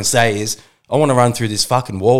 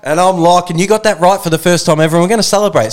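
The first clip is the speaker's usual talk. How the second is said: excited; 6 semitones higher, 4 dB louder than usual. Real, not acted. Co-host hyped intro.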